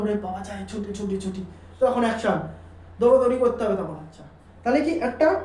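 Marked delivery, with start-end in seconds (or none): 0.0-1.4 lower, softer comic wail for release; mock urgent plea. The rectum begging to empty, a mock desperate plea. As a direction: plead to be let go, hammed-up but hushed. Body urging a toilet visit.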